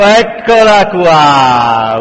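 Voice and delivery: long, drawn-out loud howl; yelled. A narrator's reading. Filthy man spots the hunted woman, yelling. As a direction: holler it out, stretching the vowels. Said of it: "urlò".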